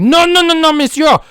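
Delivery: high, loud insisting; hammed-up pushy fan; pushy shouting fan. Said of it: "And you're like".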